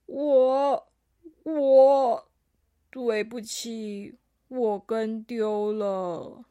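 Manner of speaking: put-on halting, drawn-out childlike apology; slow, abashed confession; sheepish little alpaca. A narrator's reading.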